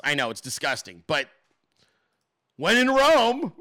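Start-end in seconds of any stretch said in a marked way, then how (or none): 2.6-3.5 high, put-on jokey delivery; joking punchline. Host caps his disgusting joke, high mock-cheery voice. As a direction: sing out the punchline in a raised voice.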